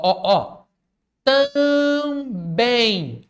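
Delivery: usual speaking voice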